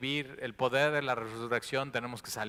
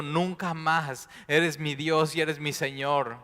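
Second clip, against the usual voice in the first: loud, high, impassioned preaching voice; preaching at full force; 4 semitones higher, 9 dB louder than usual. Acted. Preacher voices Mary's desperation at Jesus' feet, loud and fervent. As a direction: lift the congregation with fervent urgency. Preacher builds to emotional peak.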